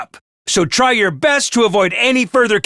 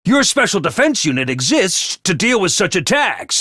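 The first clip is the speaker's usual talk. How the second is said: ordinary delivery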